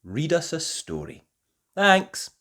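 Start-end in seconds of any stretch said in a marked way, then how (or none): 1.8-2.3 loud, high cheerful bye; cheery goodbye. Real, not acted. The host signs off to viewers, loud and cheerful. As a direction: wave the audience off. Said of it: "cheerful sign-off".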